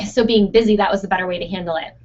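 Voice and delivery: normal talking voice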